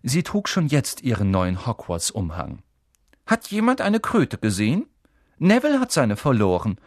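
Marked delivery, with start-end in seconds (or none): 3.3-6.8 put-on high, loud, imperious girl; bossy. A narrator's reading. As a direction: demand it like you're in charge. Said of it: "sagte sie mit gebieterischer Stimme".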